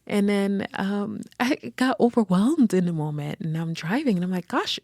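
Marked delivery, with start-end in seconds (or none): 4.5-4.8 high startled gosh; startled gasp. Retold or imitated. Mother driving after the scare, high startled gosh. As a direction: blurt it out shaken. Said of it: "I kept saying out loud".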